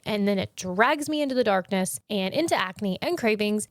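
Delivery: ordinary delivery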